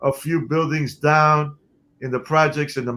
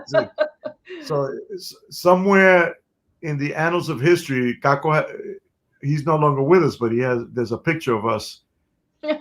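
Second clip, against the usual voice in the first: ordinary delivery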